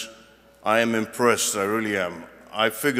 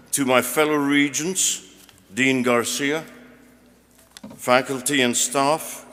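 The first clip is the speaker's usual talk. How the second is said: higher formal salutation to an audience; formal speech; 4 semitones higher, 3 dB louder than usual. Real, not acted. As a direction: address the hall in measured phrases. Reads out prepared commencement address.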